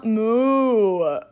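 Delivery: long, loud drawn-out moo; old-man cow moo. Acted. Cow voiced as old man, long loud moo. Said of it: "cows sound like, like, old men".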